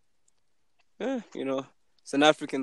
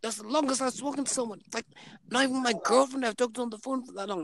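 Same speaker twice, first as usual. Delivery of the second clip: mock chatty stranger; 11 semitones higher, 4 dB quieter than usual. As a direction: play the overly chatty guy. Imitating same guy continuing.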